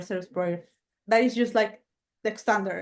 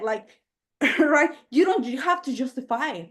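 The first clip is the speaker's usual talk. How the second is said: ordinary talk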